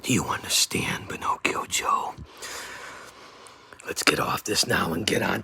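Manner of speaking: soft whispered threat; taunting whisper to the rival; menacing whisper. Acted. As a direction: lean in and whisper it.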